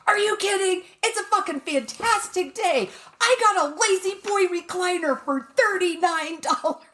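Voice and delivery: high-pitched excited gushing; uncontainable excitement. Retold or imitated. Woman telling the cashier about her good day, uncontainably excited. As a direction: blurt it out high, bursting with the news. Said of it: "I can't contain myself any longer".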